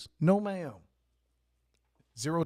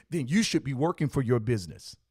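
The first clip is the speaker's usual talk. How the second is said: normal talking voice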